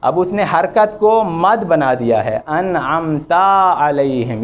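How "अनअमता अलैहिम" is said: अनअमता with the final a stretched into an alif; wrong long ending. Shown as a demonstration. Teacher showing a common recitation mistake. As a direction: slowly drag out the ता in अनअमता. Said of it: "ये गलत है".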